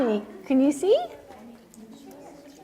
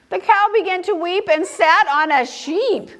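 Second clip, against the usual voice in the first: higher, lilting storybook narration; story reading to children; 4 semitones higher than usual. A narrator's reading. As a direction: read it to little ones, slowing at the end. Reads picture book aloud.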